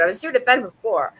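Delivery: ordinary talk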